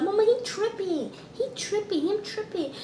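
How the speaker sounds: high, soft whine to mama; whiny complaint. Retold or imitated. Kid calling mama about the teacher, whining.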